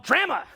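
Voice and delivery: "drama" with a short flat A as in "cat"; Canadian pronunciation. Shown as a demonstration. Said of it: "That's how we pronounce it in Canada".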